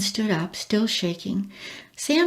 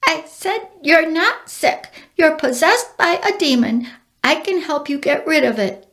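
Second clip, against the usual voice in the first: bird voice, calm; 7 semitones higher than usual. A narrator's reading. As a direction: voice the bird gently but firmly. Bird character speaks.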